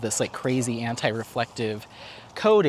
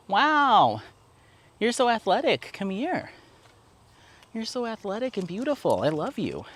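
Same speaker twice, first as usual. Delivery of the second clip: dog praise voice; 9 semitones higher than usual. Real, not acted. Owner praising dog Apollo after the jump, high and doting.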